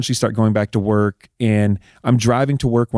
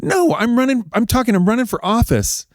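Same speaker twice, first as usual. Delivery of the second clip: high-pitched indignant insistence; a bit angry; 10 semitones higher than usual. Retold or imitated. Husband imitating his wife announcing her run, high and a bit angry. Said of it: "now she's actually a little bit angry".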